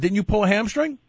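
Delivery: ordinary talk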